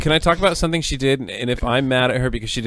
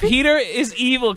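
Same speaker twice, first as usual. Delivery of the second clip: normal talking voice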